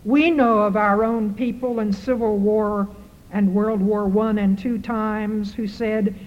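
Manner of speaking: normal talking voice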